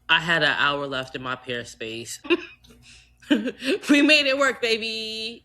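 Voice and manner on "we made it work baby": higher, louder victory cry; triumphant. Real, not acted. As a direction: shout it out like a win. Triumphant exclamation.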